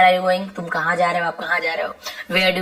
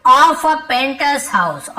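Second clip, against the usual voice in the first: slow dictation; 4 semitones higher, much slower than usual. Shown as a demonstration. Teacher reading the lesson sentence to students, slow and deliberate. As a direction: read it out slowly so the class can follow.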